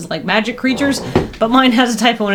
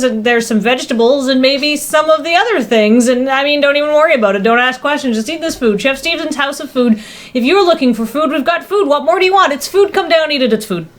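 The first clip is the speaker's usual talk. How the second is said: ad read voice; 4 semitones higher than usual. Acted. Reads Chef Steven sub commercial.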